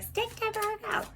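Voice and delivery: very high squeaky quote; mock Virgo complaint. Virgo telling the reader off, mock high voice. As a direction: imitate a put-out listener in a tiny high voice.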